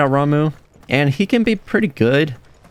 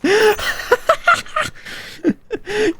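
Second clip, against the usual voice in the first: much higher, laughing "where did he go?"; giggling squeal; faster than usual. Real, not acted. Gamer cracking up at Jill's attack, asking through high giggles.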